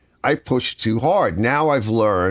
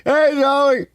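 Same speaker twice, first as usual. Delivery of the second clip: much higher, louder call of hey Joey; loud greeting call; 4 dB louder than usual. Retold or imitated. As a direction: shout the greeting up high across the room. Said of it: "He goes".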